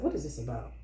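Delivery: quiet, low question to Spirit; subdued, retold prayerful question. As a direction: ask it softly and low.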